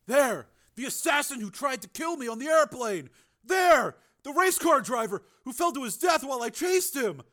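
high, feverish raving; crazed alarm. A narrator's reading. Crazed brother showing the dead, crazed alarm. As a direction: point each one out in alarm. Pointing out imagined dead men.

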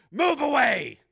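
high, loud command; shouted order. Acted. Steele ordering the pimp from his car, shouted. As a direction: order him off at full force.

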